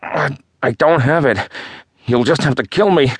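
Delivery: high, strained voice in pain. Acted. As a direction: force the words out through pain. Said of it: "Zach struggled to answer through the pain".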